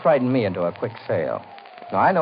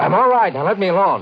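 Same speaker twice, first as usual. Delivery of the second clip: higher, louder sharp reply; irritable snap; 7 semitones higher, 6 dB louder than usual. Acted. Widower snaps at housekeeper to leave him alone. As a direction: brush her off sharply.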